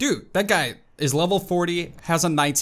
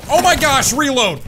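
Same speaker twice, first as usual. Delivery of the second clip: high, loud alarm; real frantic outburst; panicked yell; 10 semitones higher, 8 dB louder than usual. Real, not acted. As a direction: shout it in the heat of the game. Panicked in rocket fight.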